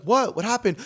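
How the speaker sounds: high-pitched startled reply; replayed shock at bad news; alarmed question. Acting out his alarmed reply.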